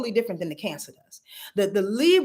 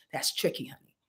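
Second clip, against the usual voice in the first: a breathy hushed aside before repeating it; whispered aside; 7 semitones lower, 10 dB quieter than usual. Real, not acted. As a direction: whisper it, almost to yourself. Aside to viewer, then repeated.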